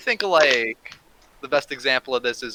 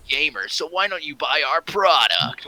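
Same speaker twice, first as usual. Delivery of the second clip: loud pumped-up commercial voice; hyped ad announcer; 2 semitones higher, 8 dB louder than usual. Retold or imitated. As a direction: sell it like a hype ad. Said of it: "Epic Gamer Moments".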